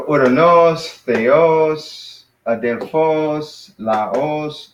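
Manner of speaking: "uranos, theos, adelphos, laos", each slowly landing on its final syllable; last-syllable stress. Shown as a demonstration. Teacher drilling words with accents on the end, end-stressed. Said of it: "all accents on the end".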